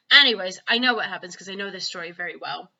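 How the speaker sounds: plain reading voice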